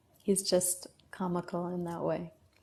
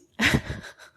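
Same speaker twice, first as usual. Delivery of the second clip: loud burst of laughter; 7 dB louder than usual. Real, not acted. Laughs at own quarantine joke.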